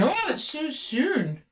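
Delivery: high, slow mock voice of the partner; a lightly put-on dismissive lover. Reader mocks Aquarius's person brushing it off, high and slow.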